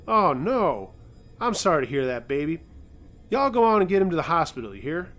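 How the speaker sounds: lightly voiced Southern old lady; a hint of a sweet Southern neighbour; easy Southern twang, chatty; southern drawl. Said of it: "in a southern drawl".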